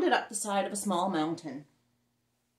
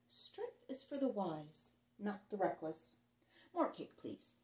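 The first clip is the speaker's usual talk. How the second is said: softer, slow and measured; calm quiet remark; 8 dB quieter than usual. Acted. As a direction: say it quietly like settled wisdom. Son's wise calm remark.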